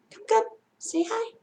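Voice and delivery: coaxing. Acted. Coaxing shy friend.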